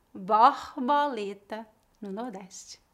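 ordinary talk, nothing marked

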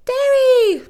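high, very loud cry of a name; shout. A narrator's reading. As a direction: scream the name across a distance. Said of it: "she would have said".

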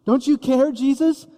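frightened disciples begging Jesus in the boat, desperate; desperate plea. Acted. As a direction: cry out as if the boat is sinking. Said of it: "They say".

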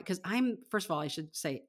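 ordinary talk, nothing marked

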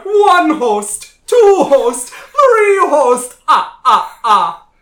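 loud, high, slow spooky host; jack o lantern voice. Acted. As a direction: ham it up like a cackling horror host. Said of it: "your spookcaster extraordinaire".

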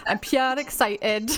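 imitating a Geordie accent, slow; light Geordie impression. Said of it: "the Geordie accent".

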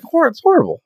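high outraged squeak. Real, not acted. Outraged at humans.

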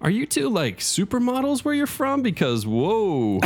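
gush, then trail into whoa; awestruck flattery. A narrator's reading. GM voices Joel flattering.

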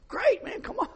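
loud, high, delighted welcome; excited shout. Retold or imitated. Preacher welcoming Omar onto the church bus, thrilled. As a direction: cheer him aboard.

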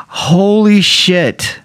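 stunned realization. Retold or imitated. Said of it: "woke up off the couch and went".

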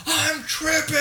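mock scream. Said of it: "my friend just starts screaming".

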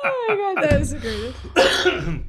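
high, laughing, drawn out; delighted and still laughing. Real, not acted. Reaction to joke.

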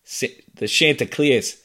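put-on broad Boston accent, dropped r. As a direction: say The Chanticleers like a Boston fan. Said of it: "Chanticleers in a Boston accent".

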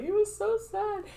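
very high-pitched tearful whine; hammed-up fake weepy voice; mock crying. Improviser explaining her crying about a dog, mock crying. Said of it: "reason that I'm crying".

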